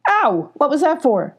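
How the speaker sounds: high, indignant yelp; pained protest. A narrator's reading. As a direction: yelp then complain, pitch up. Stung guard protests.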